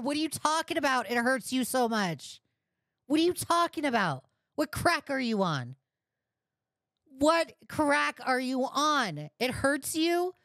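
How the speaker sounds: angry yelling. Real, not acted. Streamer berating the user on stream, high furious yell. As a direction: yell it at him in disgust.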